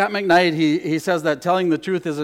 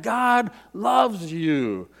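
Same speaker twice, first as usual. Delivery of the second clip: slow gentle statement; much slower than usual. Shown as a demonstration. Preacher to the congregation, gently and slowly. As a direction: let it settle slowly. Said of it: "We keep saying that".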